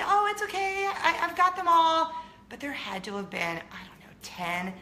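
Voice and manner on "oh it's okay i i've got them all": high, loud, bright reassuring; smooth it over kindly; sweet reassurance. Retold or imitated. Friend calling up to neighbors, high and reassuring. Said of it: "did look up and say".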